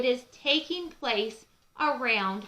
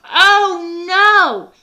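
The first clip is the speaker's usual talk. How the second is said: high, loud, slow horrified cry; dismayed turkey; 10 semitones higher, 14 dB louder, much slower than usual. Acted. Ollie the duck horrified at the turkey's fate. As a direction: gasp it out in dismay. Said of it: "Ollie said".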